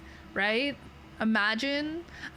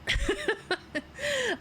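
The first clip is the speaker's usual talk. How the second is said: short high-pitched giggle; bright little laugh; 12 semitones higher than usual. Real, not acted. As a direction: giggle at your own joke. Laughs at own remark.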